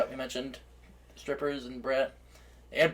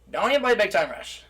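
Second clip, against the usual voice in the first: higher, louder mock telling-off; raised, falling mock warning; imitated warning; 6 semitones higher, 5 dB louder than usual. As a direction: imitate mom forbidding it. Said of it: "video of her saying that".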